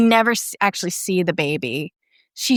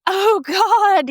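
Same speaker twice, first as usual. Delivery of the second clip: much higher, mock anguished cry; 12 semitones higher, 3 dB louder than usual. Retelling the film, narrator voices Rosemary crying out in despair. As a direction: wail it in despair. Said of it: "she's crying".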